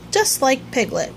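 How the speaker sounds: ordinary delivery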